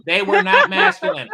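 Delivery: sharp high outcry, stress on not; indignant shout. Real, not acted. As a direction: fire back in disbelief. Flat denial in heated debate.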